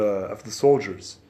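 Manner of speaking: normal talking voice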